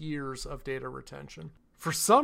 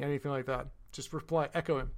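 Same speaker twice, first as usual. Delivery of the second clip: ordinary talk, nothing marked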